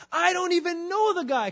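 high, loud, vehement denial. Acted. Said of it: "That's what he was saying".

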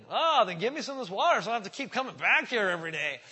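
loud, high, flippant retort; loud cheeky brush-off. Retold or imitated. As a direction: toss it back at him, cheeky. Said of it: "flippant with Jesus".

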